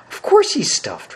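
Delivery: high, drawn-out exasperated retort; still angry. A narrator's reading. Dorothy answers the lion's question, still angry. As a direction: answer crossly, pitched high and stretched out. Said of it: "who was still angry".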